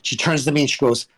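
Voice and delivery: normal talking voice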